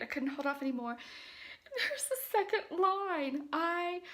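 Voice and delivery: quiet, stunned, breathless disbelief; barely above a whisper, overwhelmed; soft trembling excitement; hushed shock. Real, not acted. Recorded clip right after test.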